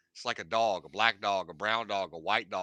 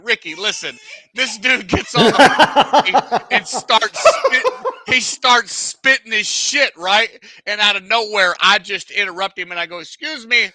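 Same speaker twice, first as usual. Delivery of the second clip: loud, high, fired-up telling; excited loud retelling; excited storytelling; 9 semitones higher, 15 dB louder than usual. Real, not acted. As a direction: tell it loud and thrilled.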